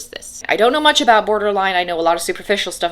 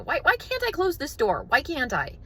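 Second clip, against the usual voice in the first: much higher, pleading mock frustration; exasperated whine; 8 semitones higher, 6 dB quieter than usual. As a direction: whine at the stuck door, fed up. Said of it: "easily irritated".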